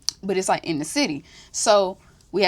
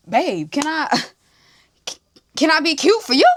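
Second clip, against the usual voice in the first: higher, louder whiny plea with a laugh; pleading babe voice; 6 semitones higher, 4 dB louder than usual. Retold or imitated. To his date, a mock-whiny plea for more time. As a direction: beg sweetly, laughing through it. Retelling his reply to girlfriend.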